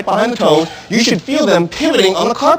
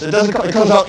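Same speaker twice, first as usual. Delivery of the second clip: normal talking voice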